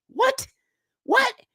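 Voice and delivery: high, rising; each one jumps way up in disbelief; stunned. Real, not acted. Viewers hear about the couple, high stunned repeated what.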